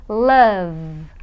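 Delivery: low, stretched "love" with a long buzzing V; voiced final V. Shown as a demonstration. Teacher demonstrates throat vibration, "love" held on a buzzing V. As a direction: hold the last sound of "love". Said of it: "ele vibra".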